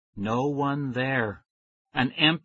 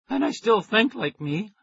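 scratchy, high; 9 semitones higher, 3 dB louder than usual. A narrator's reading. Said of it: "My voice came out scratchy and high".

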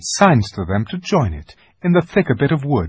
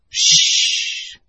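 hushing; 3 dB louder than usual. Acted. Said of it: "said the beaver".

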